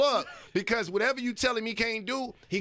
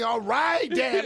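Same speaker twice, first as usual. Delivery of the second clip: exasperated "All right, Dad", a bit louder; annoyed teen; 4 semitones higher, 4 dB louder, much slower than usual. Retold or imitated. Son brushing off his lecturing father, fed up. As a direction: sigh it off like a fed-up kid.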